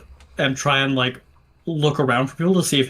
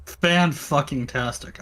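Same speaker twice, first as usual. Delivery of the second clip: slow, savoring each syllable; drawn out; 3 dB quieter, much slower than usual. Real, not acted. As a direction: stretch it out, pleased. Pleased, drawn-out exclamation.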